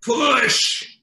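high, drawn-out doctor's shout. Acted. Doctor to labouring wife in the birthing room, shouting. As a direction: belt it out like a doctor urging on a birth. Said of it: "and he's shouting".

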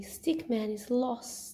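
ordinary delivery, nothing marked

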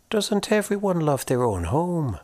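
put-on high, lilting old-world woman; curious and kindly; Una's voice. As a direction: ghost woman asking the boy about his corpse. Una voiced.

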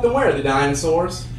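normal talking voice

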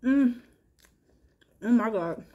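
ordinary talk, nothing marked

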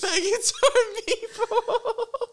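high, drawn-out words dissolving into laughter; helpless laughter. Real, not acted. Friend repeats the absurd line to host, laughing helplessly. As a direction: wheeze it out slowly while cracking up.

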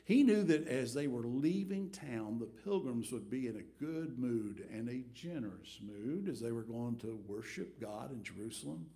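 usual speaking voice

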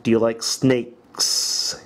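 very slow, each word laid out clearly; slow teacher modelling of "Do you like"; slow emphasized questions. Shown as a demonstration. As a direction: spell out every word for the class. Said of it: "Emphasize it".

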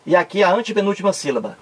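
ordinary talk, nothing marked

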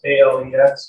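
ordinary delivery

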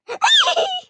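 high, loud laugh bursting out; burst of laughter. Real, not acted. Gamer laughing at her own scream, loud high laugh. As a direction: crack up at yourself.

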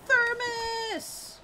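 high, urgent calling out; yelling Thermos. Real, not acted. Streamer calling out for a thermos mid-fight, high urgent yell.

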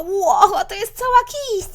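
usual speaking voice

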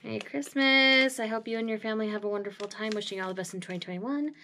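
fast, soft read-aloud; reading a card under the breath. Real, not acted. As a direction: skim the note aloud to yourself.

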